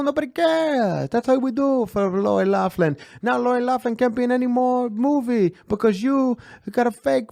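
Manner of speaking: a hammed-up Italian husband mocking the school scam; Italian impression. Podcast host imitating Massimo in a hammed-up Italian accent. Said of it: "I'm gonna work on my Italian impression".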